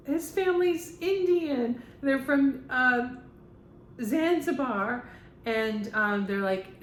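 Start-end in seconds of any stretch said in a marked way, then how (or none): 0.1-5.1 retold astonishment. Retells her astonished argument.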